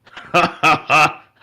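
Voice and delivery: big hearty burst of laughter; the human laughs out loud at their request. Acted. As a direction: throw your head back and laugh. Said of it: "the human starts laughing".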